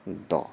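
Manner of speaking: द said short with a schwa, like "duh". Shown as a demonstration. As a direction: say "द" clipped, not "दी". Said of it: "इसका भी उच्चारण हम क्या करेंगे". ("द" छोटा, हल्का उच्चारण)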